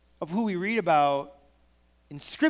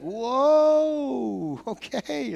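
higher, long drawn-out "whoa"; mock surprise; 6 semitones higher, much slower than usual. Reacting to a friend who never gets angry, exaggerated surprise. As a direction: act taken aback by a sudden outburst. Imagined reaction to unexpected anger.

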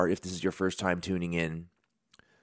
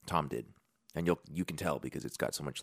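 ordinary talk

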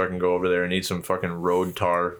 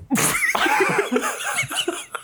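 laughing. Real, not acted. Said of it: "after his impression".

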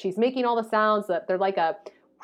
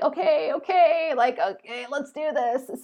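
higher, nervous okays; psyching up; 6 semitones higher, slower than usual. Retold or imitated. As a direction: pump yourself up against nausea. Psyching herself up, nauseous.

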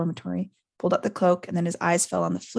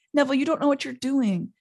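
higher, exasperated appeal; 7 semitones higher than usual. A narrator's reading. Ron tells Neville he doesn't understand, exasperated. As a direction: plead with him, a touch fed up. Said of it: "said Ron in exasperation".

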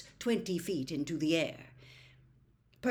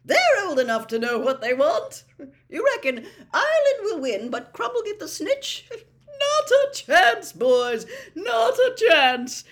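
loud, high, jovial bookmaker's patter; excited, booming heartiness; booming excitement; 11 semitones higher, 11 dB louder than usual. A narrator's reading. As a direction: egg on the bettors with huge gusto. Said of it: "rattling his pockets excitedly".

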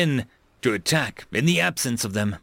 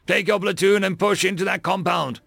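raised, higher-pitched command; shouted order; 6 semitones higher than usual. A narrator's reading. Colonel orders a lieutenant into the compound, shouting. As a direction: order troops forward over battle noise. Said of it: "Michaels shouted".

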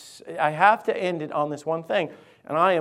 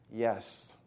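low, soft little yes; teen's reluctant answer; 6 semitones lower, 9 dB quieter than usual. Acted. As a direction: answer softly and low, as the questioned kid. Playing a teenager answering counselor's questions.

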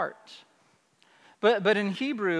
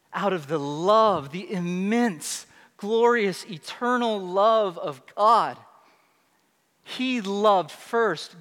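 slow emphatic; slower than usual. Acted. Slow emphatic preaching line.

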